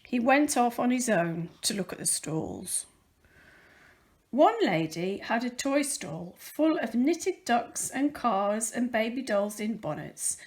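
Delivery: usual speaking voice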